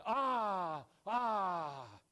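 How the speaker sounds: high, loud cry of "ah"; loud shout. Retold or imitated. Graham shouting in his dream, loud and high. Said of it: "he shouted so loud".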